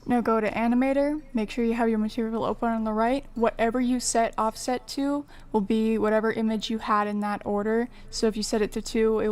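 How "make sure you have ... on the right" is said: ordinary talk, nothing marked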